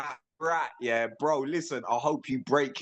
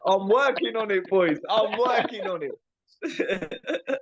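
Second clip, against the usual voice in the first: laughing; 9 semitones higher, 3 dB louder than usual. Real, not acted. Teased, answers laughing.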